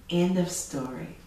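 usual speaking voice

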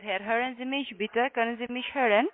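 normal talking voice

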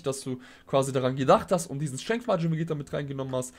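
normal talking voice

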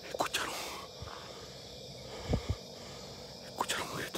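barely audible whisper; real whisper. Real, not acted. Ghost hunter asking viewers if they heard the scream, whispering. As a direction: whisper it very softly.

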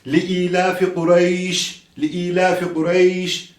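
slow "ilafi" with the opening i held long; elongated i. Shown as a demonstration. Quran teacher modelling the correct verse, vowel drawn out. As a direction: stretch the i in "ilafi", slowly. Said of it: "burayı uzatıyoruz".

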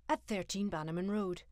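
usual speaking voice

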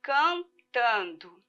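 cantando stretched slowly; slow, drawn out. Shown as a demonstration. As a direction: draw out cantando for the class. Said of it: "Se vocês olharem, ó".

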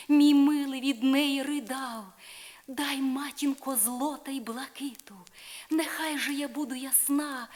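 put-on young woman imploring her godmother; pleading Isolde. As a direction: beg the fairy for beauty with rising hope. Isolde begs again.

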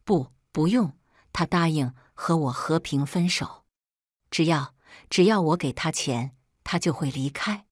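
ordinary delivery

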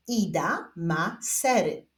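slow word-by-word reading; measured beginner's reading with spaced words; reading demonstration. Shown as a demonstration. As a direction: read aloud slowly for learners to follow. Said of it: "zapraszam Was do odczytywania zdań".

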